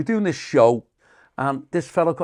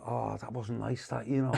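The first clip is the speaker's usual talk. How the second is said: regretful mutter; 5 semitones lower, 12 dB quieter than usual. Retold or imitated. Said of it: "I went".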